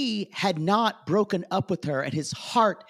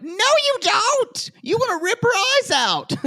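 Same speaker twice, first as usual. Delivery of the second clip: vehement protest; 6 dB louder than usual. Retold or imitated. Host retelling the reaction to a friend feeling bad for her, vehement high yell. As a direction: yell it in exaggerated outrage.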